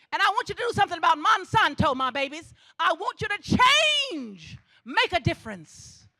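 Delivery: loud, fast preaching push. Acted. Speaker urging graduates to eat better, preaching loud. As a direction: drive the message home to the crowd.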